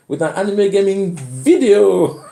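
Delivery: playful imitation of an excited young YouTuber; mimicking a YouTuber intro. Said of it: "he goes like".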